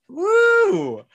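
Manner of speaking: loud high woo; high cheer. Real, not acted. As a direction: whoop like a fan. Cheering guest.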